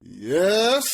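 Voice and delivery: drawn-out, rising high "Yes?"; imitated annoyed reply. T I turning to the man who kept calling, mock annoyed. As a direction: answer an interruption with a long questioning lift. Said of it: "turns and is like".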